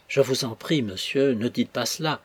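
usual speaking voice

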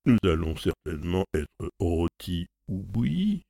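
ordinary delivery

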